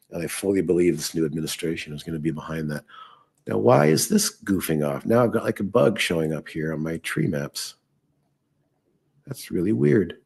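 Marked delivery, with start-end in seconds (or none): none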